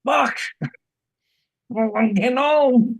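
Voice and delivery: high, exasperated mutter to oneself; rueful self-talk. Retold or imitated. Alone in the car after a hard walk, rueful self-talk. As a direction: grumble at your own aging. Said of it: "saying to myself in the car".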